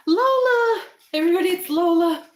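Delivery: loud, high, delighted call to the dog; light up and call her name out; excited greeting. Real, not acted.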